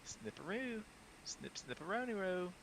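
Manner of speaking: put-on very high playful baby talk; high silly voice. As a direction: coo the nonsense words high. Silly baby-talk snipping words.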